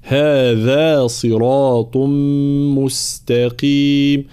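هذا with the ها and ذا stretched long; lengthened recitation. Shown as a demonstration. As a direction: draw out both vowels of هذا. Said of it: "هذا مد في الهاء ومد في الذال".

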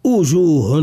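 وجوه begun with a glottal u, no w; waw turned to hamza. Shown as a demonstration. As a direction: say وجوه as 'ujuh, the common mistake. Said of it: "كثير ممن يقرؤون هكذا".

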